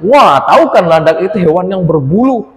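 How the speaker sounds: ordinary delivery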